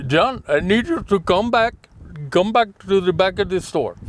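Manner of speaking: high-pitched put-on Indian accent; imitated Indian accent. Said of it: "I'm gonna do the accent".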